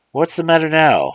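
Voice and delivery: lightly voiced, curious lady; Miss Sally. Miss Sally asks Remus what Mars John said, curious. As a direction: ask the old man what he heard.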